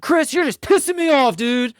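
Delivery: high, loud hammed-up irritated wife; mock angry wife. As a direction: play the wife snapping at her husband, overdone. Voicing wife's angry mask.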